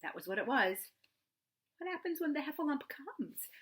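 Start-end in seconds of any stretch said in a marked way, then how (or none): 1.8-3.5 trembling. Acted. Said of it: "asked Piglet tremblingly".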